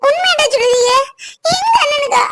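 high, distressed disbelief; tearful shock. Acted. Sister hearing her brother had an accident, tearful shock. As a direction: plead through rising tears for good news.

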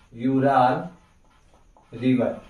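Ural river drawn out very slowly; teacher stretching Ural river for notes; slow dictation. Shown as a demonstration. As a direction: say it slowly while writing. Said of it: "मैं यहाँ पे लिख देता हूँ".

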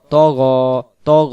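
ত গ with heavy "o" vowels; heavy letters. Shown as a demonstration. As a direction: punch out ত গ as thick "to gho". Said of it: "ত গ দুই অক্ষরে মোটা হবে".

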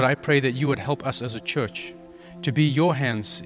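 usual speaking voice

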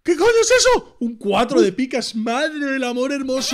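loud, high cry of disbelief; real shouted astonishment; astonished outburst. Real, not acted. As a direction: explode in amazement, then ease down a little. Astonished reaction to the card.

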